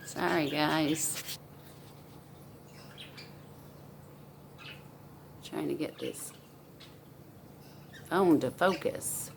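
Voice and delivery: low-key aside off to the side; quiet aside. Real, not acted. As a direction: say it under your breath while busy. Aside while fixing the phone.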